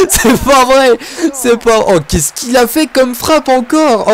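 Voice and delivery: laughing in high-pitched disbelief; can't believe that shot, laughing through the words; real laughter breaking into the exclamation. Real, not acted. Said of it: "Alors je rigole".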